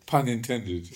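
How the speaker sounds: laughing at own pun. Real, not acted. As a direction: laugh through the words.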